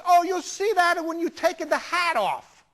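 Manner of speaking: high, loud, slow Italian grandma punchline; Italian grandmother accent. Retold or imitated. Grandmother answers photographer's hair question, excited Italian accent. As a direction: belt it out gleefully, drawn out, in Italian-accented English. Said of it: "nice little old Italian lady".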